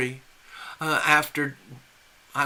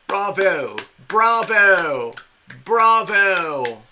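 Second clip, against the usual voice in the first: sarcastic bravo; 4 semitones higher, 6 dB louder, much slower than usual. Acted. Sarcastic applause at news.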